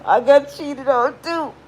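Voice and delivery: a hint of a weepy, sympathizing friend; mock sympathetic friend. Mocking the friend who cries along, a lightly weepy voice. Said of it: "snotting and falling on the floor".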